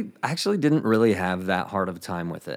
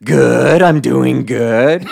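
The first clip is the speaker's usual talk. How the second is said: slow, much louder flustered shout; nervous missionary yelling back an answer nobody asked for; awkward shouted answer; 3 semitones higher, 11 dB louder, much slower than usual. Retold or imitated. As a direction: shout the wrong answer in a panic. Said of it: "That's how I said it".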